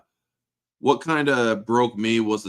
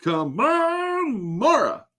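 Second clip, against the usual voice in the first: exasperated lament; much slower than usual. Retold or imitated. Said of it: "It's like".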